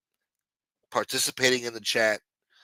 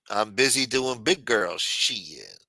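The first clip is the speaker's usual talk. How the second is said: ordinary talk, nothing marked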